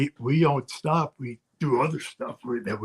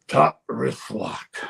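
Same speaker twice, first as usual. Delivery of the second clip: slow, deliberate wrestling call; slow command; 2 semitones lower, much slower than usual. Retold or imitated. Trainer slowly calling "Top wrist lock" to Bob. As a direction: spell out the hold slowly, level-voiced. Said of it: "To my face".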